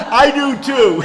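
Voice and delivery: very loud, high, laughing agreement. Real, not acted. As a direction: shout it through your laughter. Amused agreement about ice cream.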